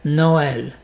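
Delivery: Noël as "no-èl", two syllables; vowels separated. Shown as a demonstration. Teacher corrects after the mistake, Noël in two syllables. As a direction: sound both o and è in Noël. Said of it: "séparer les sons".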